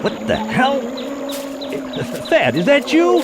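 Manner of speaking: sudden high alarmed cry, voice jumping up; startled shout. Acted. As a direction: call out in a fright, loud and high. Startled alone in the woods.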